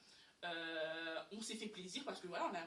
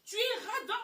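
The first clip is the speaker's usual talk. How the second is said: tu es radin much higher and louder, laughing; accusing outburst; 11 semitones higher, 6 dB louder than usual. Retold or imitated. Telling boyfriend he is stingy, loud laughing accusation. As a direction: throw the accusation at him, laughing. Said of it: "Je lui dis".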